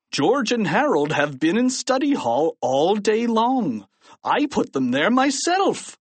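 high, swooping exasperated protest; principal's baffled outburst; crying out. A narrator's reading. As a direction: insist on it, worked up. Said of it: "cried Mister Krupp".

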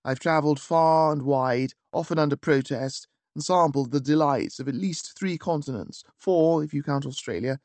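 ordinary delivery

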